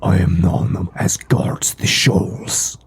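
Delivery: slow, eerie whisper of a sea monster; whispering fish creature. Acted. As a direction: hiss your name from the deep.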